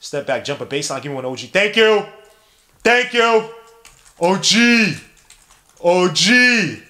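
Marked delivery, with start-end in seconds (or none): 1.5-6.8 high, loud calls to the player; elated cheering. Real, not acted. Fan cheering O G Anunoby's basket, elated shouting. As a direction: shout your thanks at the scorer.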